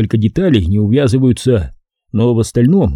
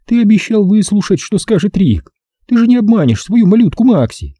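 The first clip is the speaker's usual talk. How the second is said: put-on pleading baby talk; 10 semitones higher, 3 dB louder than usual. A narrator's reading. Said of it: "умоляющим выражением лица".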